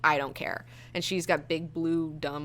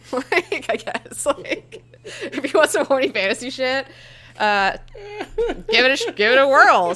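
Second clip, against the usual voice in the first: higher, louder words squeezed through real laughter; laughing; 7 semitones higher, 6 dB louder than usual. Real, not acted. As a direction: laugh while you wrap up the pitch.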